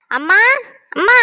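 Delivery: high rising call across the house; rising yells of amma; calling out for mom. Acted. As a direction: shout for mother to come. Girl calls mother for help.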